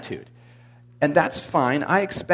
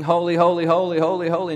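mildly raised, rapid repeated cry; mock yelling; 3 semitones higher, 3 dB louder than usual. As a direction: chant it like overwhelmed angels, half-yelled. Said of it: "they go flying around yelling".

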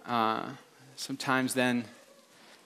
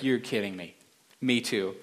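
low, soft amazement; understated, quiet disbelief; hushed disbelief; 5 semitones lower, 5 dB quieter than usual. Acted. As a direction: act stunned by the coincidence, voice dropped. Joke: awed disbelief at coincidence.

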